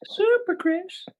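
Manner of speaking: high put-on comic voice; impression of TV host. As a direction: pitch it right up for the catchphrase. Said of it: "wanted to do my impression then".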